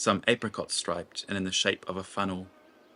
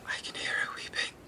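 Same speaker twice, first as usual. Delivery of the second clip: breathy higher whisper of dread; pained whisper; 6 dB quieter than usual. Acted. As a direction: breathe it as if sensing far-off suffering. Nima hears distant weeping.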